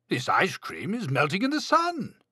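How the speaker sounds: high, alarmed storybook voice; worried rise in pitch; dismayed Toad. A narrator's reading. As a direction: fret as it melts away. Toad alarmed by melting cones.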